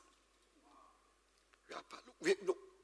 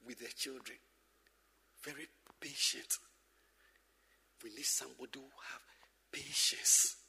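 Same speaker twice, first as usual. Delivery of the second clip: softer, lower whisper of the point; 8 semitones lower, 7 dB quieter than usual. Real, not acted. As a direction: lower the voice to stress patience. Preacher dropping to stress patience.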